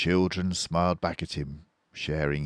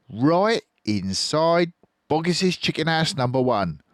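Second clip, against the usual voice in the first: higher, louder proud fox; sly boasting Fox; 11 semitones higher, 6 dB louder than usual. A narrator's reading. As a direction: brag of the raid.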